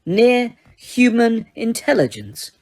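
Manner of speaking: slow, mocking rise on Near-human intelligence; scornful repeat. A narrator's reading. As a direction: drag the phrase out in disbelief. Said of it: "repeated Megorian".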